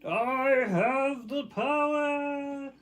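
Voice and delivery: mock heroic cry. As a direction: ham it up like a cartoon hero. Echoing her line about power.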